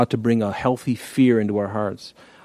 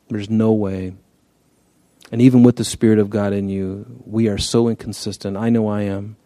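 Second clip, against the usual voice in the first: normal talking voice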